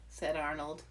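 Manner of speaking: plain reading voice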